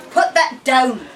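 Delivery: loud commanding cry; cried out. Acted. Kell ordering the boys to drop the sack, loud cry. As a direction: stop them dead with your voice. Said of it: "she cried".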